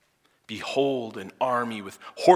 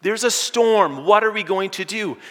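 panicking; 11 semitones higher, 8 dB louder than usual. Retold or imitated. Frightened servant begs Elisha for help, in a high loud panic. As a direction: cry out in panic, much higher and louder. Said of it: "And he's panicking".

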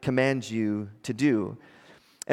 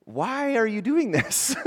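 retold bewildered question; 7 semitones higher than usual. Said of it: "I remember going".

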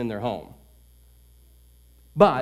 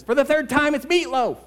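higher, louder exasperated retort; exasperated snap; 11 semitones higher, 4 dB louder than usual. Retold or imitated. Wife whirling around on her husband, exasperated and loud. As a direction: fire back, fed up.

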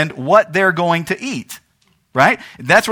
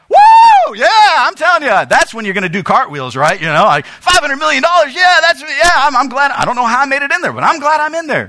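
loud, high whooping joy; excited whoop; 8 semitones higher, 4 dB louder than usual. Pretend. Imagined heir hearing of the fortune, shouting with joy. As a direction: yell it like you just won millions. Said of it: "You'd be like".

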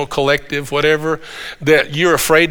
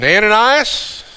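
calling his name; 6 semitones higher, slower than usual. Acted. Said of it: "the Lord said to him".

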